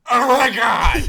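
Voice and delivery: high, drawn-out mock shout; hammed-up loud, slow cry of dismay; horrified movie outburst. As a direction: wail it loudly as a humiliated character. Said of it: "Yeah, just like that".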